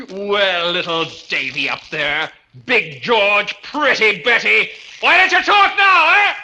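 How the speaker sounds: gloating mad taunt. Acted. Brooks gloating at the silent bells, shouting. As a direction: jeer at the bells in triumph.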